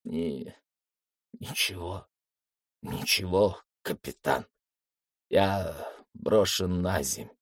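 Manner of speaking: faint, faltering, stopping between words; barely holding on after the fall, slow and halting; weak broken voice. Acted. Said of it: "слабый, прерывающийся голос зоолога". (слабый прерывающийся голос)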